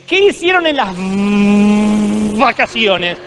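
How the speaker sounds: put-on high, loud old-school teacher; señorita maestra. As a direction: quiz the class, drawing out the V of "vacaciones". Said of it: "En mi tiempo la señorita te decía". (maestra de antes, aguda y enfática)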